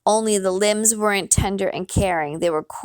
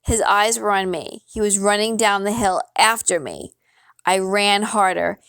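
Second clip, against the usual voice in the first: ordinary delivery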